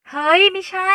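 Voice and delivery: loud, very high yelp; startled denial. Acted. Sister caught out by her brother, startled. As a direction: jump and deny it at once. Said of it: "หมิงสะดุ้งเมื่อได้ยินพี่ชายถามโพร่งออกมา".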